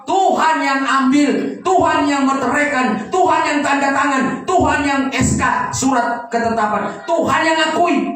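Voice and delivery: high, loud emphatic preaching; preaching at full force. Acted. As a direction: hammer each Tuhan home. Preacher repeats Tuhan emphatically.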